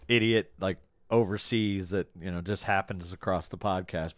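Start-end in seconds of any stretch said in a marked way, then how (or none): none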